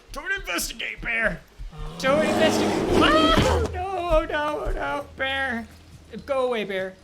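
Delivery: loud high yelling at a bear; scaring off a bear. Real, not acted. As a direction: shout it away in panic.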